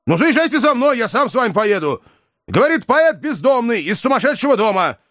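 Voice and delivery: high, urgent phone shouting. A narrator's reading. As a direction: yell down the line. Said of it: "закричал Иван в трубку".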